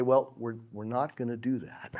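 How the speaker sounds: deliberate, gentle firmness. Acted. Showing how softness gives strength, deliberate and quiet. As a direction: set the limit quietly and deliberately. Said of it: "speaking more deliberately".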